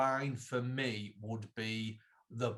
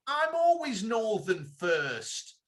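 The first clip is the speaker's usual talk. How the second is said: slow, raised mock London accent, louder; 7 semitones higher, 4 dB louder, slower than usual. Repeating Mark's words for a puzzled co-host, slow mock London accent. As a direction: repeat the quote slowly in a poor London accent. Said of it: "I didn't do his accent very well".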